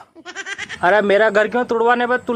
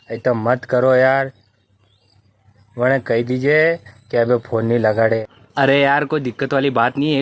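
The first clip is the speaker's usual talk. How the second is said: gruff friend; 9 semitones lower than usual. Acted. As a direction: grumble low like a henpecked husband. Other friend voiced, complaining.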